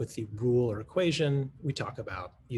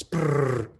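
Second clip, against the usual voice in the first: mock racing noise; 6 dB louder than usual. Kids racing through the problem.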